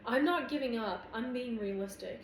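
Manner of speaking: clipped, defensive retort; terse insistence. A narrator's reading. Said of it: "My words are clipped".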